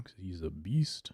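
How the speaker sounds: slow, breathy whispered aside; whispering. Real, not acted. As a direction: whisper it to yourself, slowly. Aside while picking cards.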